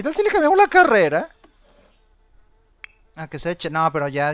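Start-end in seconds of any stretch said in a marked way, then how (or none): 0.0-1.3 playful. Real, not acted. Joking about career change.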